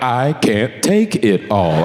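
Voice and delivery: breathy Siri. Retold or imitated. Siri quoted overwhelmed.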